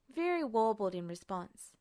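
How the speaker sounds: ordinary delivery, nothing marked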